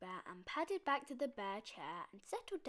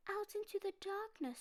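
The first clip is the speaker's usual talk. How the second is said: little bear cub; 11 semitones higher than usual. A narrator's reading.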